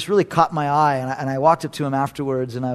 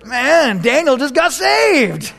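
loud, much higher joyful cry; excited amen; 8 semitones higher, 6 dB louder, much slower than usual. Retold or imitated. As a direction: celebrate a new believer. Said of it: "I was like".

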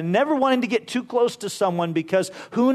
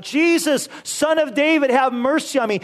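higher, louder desperate plea; crying out; 7 semitones higher, 4 dB louder than usual. Retold or imitated. Blind beggar crying out to Jesus for mercy. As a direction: call out to Jesus over the crowd. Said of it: "Bartimaeus is crying out".

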